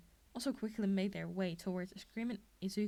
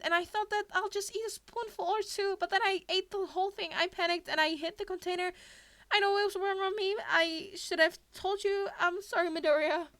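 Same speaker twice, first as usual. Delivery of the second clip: put-on high, loud, rushed-out girl's apology; panicked confession; 9 semitones higher, 9 dB louder than usual. A narrator's reading. Ashido's tearful panicked confession.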